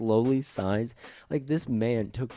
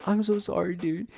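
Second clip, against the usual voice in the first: high-pitched, louder imitation of a weepy apology; mock tearful apology; 11 semitones higher, 4 dB louder than usual. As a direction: play up the stoner's sobby sorry. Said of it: "he's apologizing".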